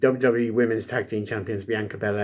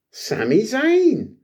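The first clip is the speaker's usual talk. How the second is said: high, drawn-out, loud announcer call; mock announcer; 12 semitones higher, 6 dB louder, much slower than usual. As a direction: announce a wrestler's big entrance, stretching the name. Mock big entrance announcement of wrestler.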